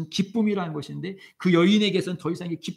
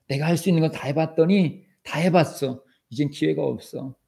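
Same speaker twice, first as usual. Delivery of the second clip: normal talking voice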